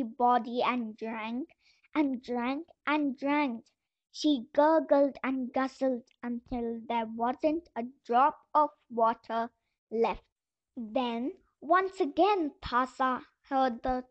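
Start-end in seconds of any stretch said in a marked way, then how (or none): none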